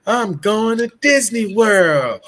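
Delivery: high, slow, over-the-top announcement; hammy stage actor. Retold or imitated. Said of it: "I started hamming it up".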